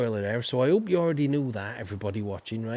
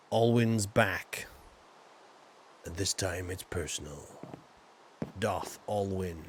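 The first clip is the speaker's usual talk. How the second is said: mock trailer voice; 6 semitones lower, 5 dB quieter than usual. Mock movie trailer announcer voice.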